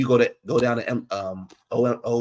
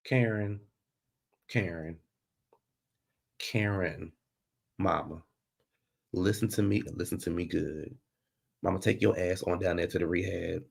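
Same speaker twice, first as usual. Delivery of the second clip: soft pleading; 5 semitones lower, 8 dB quieter than usual. Real, not acted. Pleading with Karen to get help.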